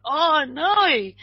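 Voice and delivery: high, sinking oh no; retold alarm; dismayed gasp. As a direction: let the realisation hit you. Realises kids are alone.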